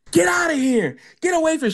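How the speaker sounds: high yelling mock of her shouting; imitating the angry woman yelling; angry homeless woman. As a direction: shout her off like a furious stranger. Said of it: "She was cursing us out".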